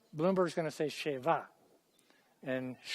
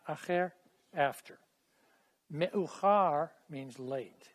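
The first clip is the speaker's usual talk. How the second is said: ordinary talk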